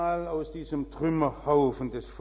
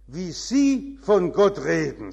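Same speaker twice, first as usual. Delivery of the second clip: indignant; 8 dB louder, slower than usual. Retold or imitated. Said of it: "sagte sie empört".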